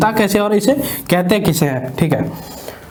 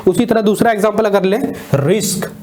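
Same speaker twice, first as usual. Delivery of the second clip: ordinary talk, nothing marked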